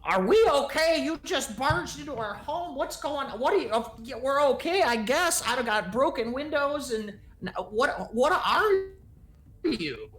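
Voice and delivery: high-pitched, rattled homeowner; indignant older woman. Acted. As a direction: pitch it high, demanding answers from intruders. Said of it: "she's like".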